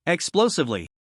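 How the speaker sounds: ordinary delivery, nothing marked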